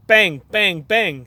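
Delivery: loud bang bang sounds. A narrator's reading. Reader imitating hammer bangs loudly. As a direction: shout out each bang. Banging noise next door.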